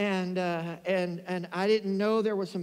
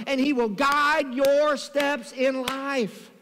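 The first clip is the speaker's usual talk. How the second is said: forceful preaching; 4 semitones higher, 3 dB louder than usual. Real, not acted. Preacher promising the congregation Jesus will guide them, emphatic. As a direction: preach it with conviction, stretching the key phrases.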